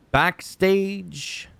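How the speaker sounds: high, drawn-out announcer call of the word Backstage. Shown as a demonstration. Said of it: "Oh, we gotta talk about the backstage".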